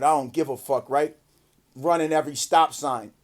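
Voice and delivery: ordinary delivery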